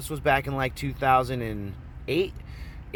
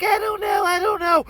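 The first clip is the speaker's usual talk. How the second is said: very high, squealed imitation; mock panicked girl; 5 dB louder than usual. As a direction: wail it like a cornered girl. Panicked girl's reply, imitated.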